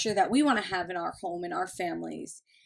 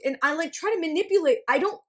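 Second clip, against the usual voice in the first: ordinary talk, nothing marked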